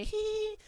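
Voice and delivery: high, mocking hee hee; mocking girly giggle. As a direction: mimic her taunting giggle. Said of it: "She's like".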